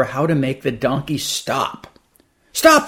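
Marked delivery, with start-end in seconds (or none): 2.5-2.9 yelled command. Acted. Man yelling at his runaway donkey, high loud yell. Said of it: "yelled the man".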